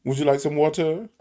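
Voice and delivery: mock proper water; prim standard water offer. Shown as a demonstration. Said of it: "of course I know how to say water".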